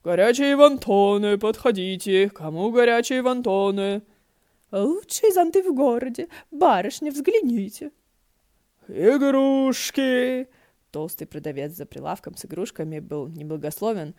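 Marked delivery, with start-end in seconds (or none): 0.0-4.0 loud, slow hawker's call; street vendor calling. Acted. 4.7-7.9 high, loud umbrella seller's pitch; hawker calling out. Acted. 8.9-10.5 long, drawn-out, high shout; loudest yell. A narrator's reading. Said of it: "орал громче всех".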